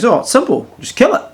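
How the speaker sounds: high, loud offhand mate voice; a mate casually urging the kill; mate Bruce, blunt. Retold or imitated.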